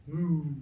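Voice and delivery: a big playful moo; loud cow moo. Pretend. Storyteller mooing loudly to the children.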